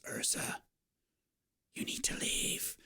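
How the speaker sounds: soft breathy warning whisper; eerie whisper. Acted. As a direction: hiss the warning under your breath. Possessed boy warns Ursa.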